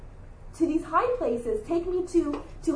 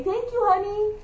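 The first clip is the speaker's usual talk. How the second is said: sweet mom; 7 semitones higher than usual. Retold or imitated. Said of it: "and I'll say".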